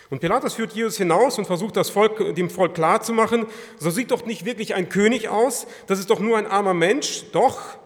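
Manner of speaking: loud, high, urgent preaching; preaching at full force. Real, not acted. Preacher pressing the congregation, loud and rising. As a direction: drive the questions home to the listeners.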